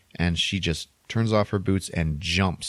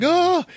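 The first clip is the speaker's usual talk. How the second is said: high, loud startled "oh"; mock startled gasp; 6 dB louder than usual. She spots the broken tether, startled high cry. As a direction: gasp out loud in sudden realization.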